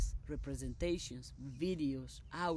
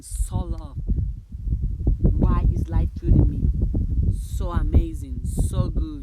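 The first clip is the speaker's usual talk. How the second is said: loud, jubilant calling out; exultant affirmation; 15 dB louder than usual. Real, not acted. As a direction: belt out your gratitude. Exuberant affirmations called out.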